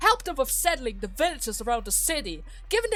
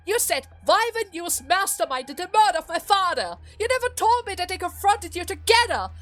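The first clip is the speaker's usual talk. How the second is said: high, forceful betrayed outburst; angry accusation; 5 semitones higher than usual. Acted. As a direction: pour out accusations in rising anger. Daughter accuses father of betrayal.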